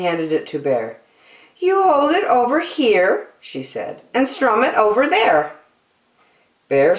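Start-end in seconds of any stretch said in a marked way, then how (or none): 1.6-3.3 much higher, friendly zebra voice; Zebra's voice. A narrator's reading. 4.1-5.5 much higher, friendly zebra voice; Zebra's voice. A narrator's reading.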